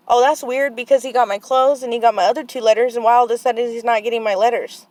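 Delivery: loud, pointed questioning; retold indignant challenge. Aunt confronting staff about missing letters, loud and indignant.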